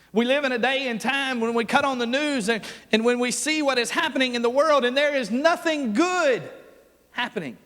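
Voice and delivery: full-force revival preaching. Acted. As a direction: drive it home from the pulpit. Preaching builds again.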